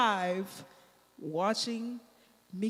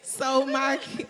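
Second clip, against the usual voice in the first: normal talking voice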